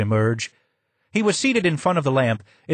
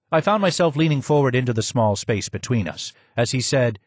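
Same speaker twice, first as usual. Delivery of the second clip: ordinary talk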